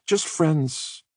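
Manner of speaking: Katie, anxiously smoothing things over; nervous reassurance. A narrator's reading. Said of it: "she kept saying".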